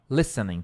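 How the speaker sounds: listening said with no T, like lissening; silent T listening. Shown as a demonstration. Teacher showing students how to say it, T left silent. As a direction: drop the T in listening, lissen-ing. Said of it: "É como se fosse assim, ó".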